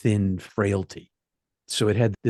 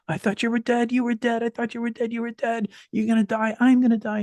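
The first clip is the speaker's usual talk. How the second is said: much higher, panicked retold cries; frantic screaming; 14 semitones higher than usual. Tim finds him dead on the cliff, frantic high-pitched panic. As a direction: imitate a terrified friend shrieking over a corpse. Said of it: "he's yelling and screaming".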